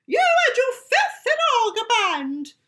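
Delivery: high, loud scolding shriek; furious shout. Acted. Shop owner shouts at cringing Lady Wallopy Thump. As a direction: bellow at the cringing customer. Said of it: "she shouted at a cringing".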